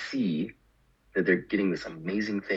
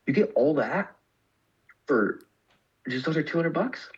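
friend's amazed question; 8 semitones higher than usual. Retold or imitated. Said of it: "She's like".

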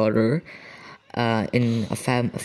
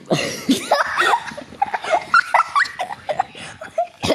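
high unvoiced giggle breaking through; real burst of high laughter; giggle fit. Real, not acted. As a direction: crack up mid-line. Breaks up laughing while reading.

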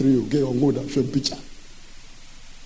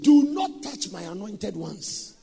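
slow, high, sung preaching; preaching at full force; 6 semitones higher, slower than usual. Acted. Preacher chanting Psalm 105 to the congregation, slow and sung.

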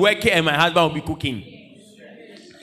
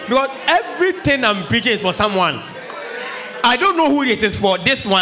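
preaching at full force; 3 semitones higher than usual. Acted. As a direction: call it out from the pulpit, teasing the ladies. Preacher insisting message is for someone.

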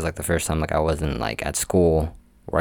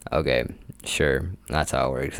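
lower, softer, slow sarcastic okay; sarcastic agreement; 4 semitones lower, 7 dB quieter than usual. Retold or imitated. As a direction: humour them with a dry, drawn-out sure. Worker dismissing know-it-all customers.

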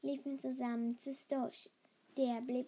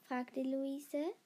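usual speaking voice

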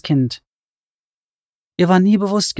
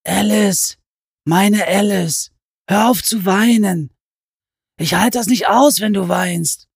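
comforting; 6 semitones higher than usual. Acted. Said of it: "die sie tröstend klopfte".

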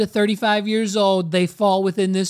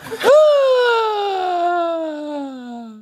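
long high whoop; 9 semitones higher than usual. Real, not acted. Host reacting to the clip's punchline, long high howl. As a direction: let out a drawn-out, descending hoot.

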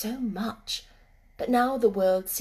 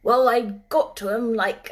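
put-on gruff old gardener; 8 dB louder than usual. Ben speaks.

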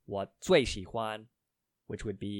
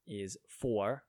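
ordinary talk

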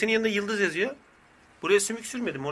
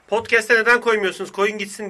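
quoted demand; 3 semitones higher, 5 dB louder than usual. Retold or imitated. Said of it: "diyor Se-, Seher Semih Selçuk".

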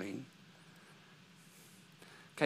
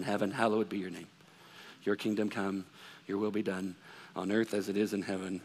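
much lower and softer, a mechanical mumbled prayer; rote recitation; 10 semitones lower, 10 dB quieter than usual. Retold or imitated. Congregation reciting the Lord's Prayer by rote, mumbled and droning. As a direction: recite it on autopilot like everyone in church. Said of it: "we just kinda go through the motions".

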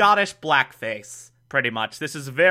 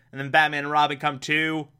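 ordinary talk, nothing marked

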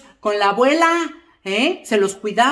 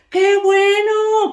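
much higher, slow, drawn-out "Qué bueno"; delighted; 9 semitones higher, 4 dB louder, much slower than usual. Acted. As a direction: sing out "Qué bueno" high and long. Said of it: "repeated, first take drawn out". ("Qué bueno" muy agudo y alargado)

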